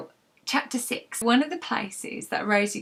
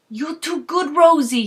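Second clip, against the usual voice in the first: a lightly voiced, higher, fond shopkeeper; smiling Frank; 7 semitones higher, 9 dB louder, slower than usual. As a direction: tease her with a grin. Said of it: "he smiled".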